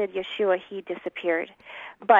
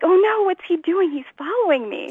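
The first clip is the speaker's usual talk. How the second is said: higher, startled mock dismay; mock alarm; 10 semitones higher than usual. Teen [name] spotting the stranger again, in higher mock alarm. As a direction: play up the panic of being followed. Her alarmed reaction at the mall.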